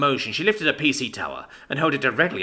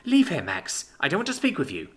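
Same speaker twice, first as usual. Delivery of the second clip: ordinary delivery, nothing marked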